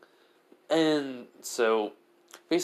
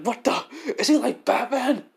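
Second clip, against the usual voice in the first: high, loud panicked voice; freaking out at the ninja; panicked thug; 7 semitones higher, 3 dB louder than usual. Acted. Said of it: "The guy's like".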